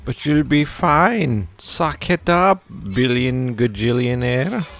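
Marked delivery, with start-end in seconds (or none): none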